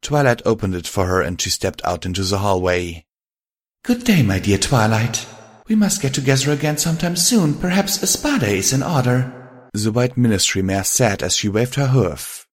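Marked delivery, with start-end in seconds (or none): 3.8-9.2 higher-pitched, cheery farewell; genteel Rarity. Acted. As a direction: part graciously from a dear friend. Rarity says goodbye.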